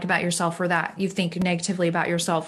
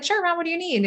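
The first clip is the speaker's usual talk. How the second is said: put-on higher, breezy teen agreeing; teen daughter's reply; 9 semitones higher than usual. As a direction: lightly voice an easygoing fourteen-year-old. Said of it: "And she's like".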